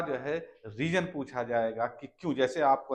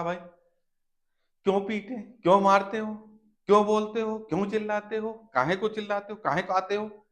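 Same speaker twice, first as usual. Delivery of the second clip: higher complaining barrage of whys; mock scolding; 7 semitones higher, 5 dB louder than usual. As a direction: scold someone who keeps bothering you. Acted scolding string of whys.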